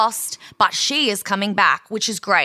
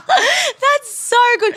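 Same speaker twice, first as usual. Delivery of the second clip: laughing; 7 dB louder, much slower than usual. Real, not acted. Laughing hard.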